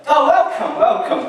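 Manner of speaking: high, loud, overbright welcome; false cheer. Retold or imitated. Narrator greeting the police officers, loud false cheer. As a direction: greet them with fake hospitality. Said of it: "I said".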